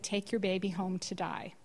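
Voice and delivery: normal talking voice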